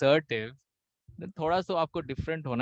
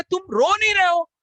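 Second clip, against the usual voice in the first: high, loud scolding father; imitating an exasperated father; angry father; 12 semitones higher, 4 dB louder than usual. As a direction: scold your son in disbelief. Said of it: "पापा बोल रहे".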